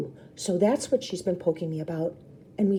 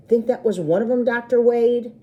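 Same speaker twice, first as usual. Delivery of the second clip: mocking joke; 6 semitones higher, 6 dB louder than usual. A narrator's reading. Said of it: "Toller jokes".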